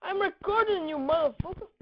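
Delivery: high, loud taunt at an opponent; excited yell. Real, not acted. Gamer taunting an opponent on the recording, excited yell.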